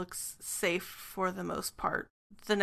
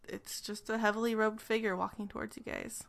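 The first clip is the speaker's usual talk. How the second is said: ordinary talk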